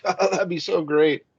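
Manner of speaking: high, gushing mock delight; hammed-up high enthusiasm; gushing counselor. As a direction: gush like an eager matchmaker. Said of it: "And she's like".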